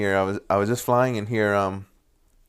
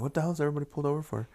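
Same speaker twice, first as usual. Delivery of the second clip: puzzled mutter; 4 semitones higher, 10 dB quieter, much faster than usual. Retold or imitated. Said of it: "I was like".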